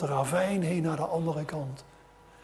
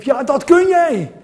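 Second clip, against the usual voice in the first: higher, loud cheering yell; crowd shouting; 10 semitones higher, 10 dB louder than usual. Acted. Crowd yelling to the tightrope walker, loud cheer. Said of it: "En iedereen riep".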